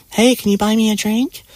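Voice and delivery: high, mock female voice; high-pitched mock drink request; imitating flirty woman. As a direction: put on a high sweet asking voice. Imitating women asking for drinks.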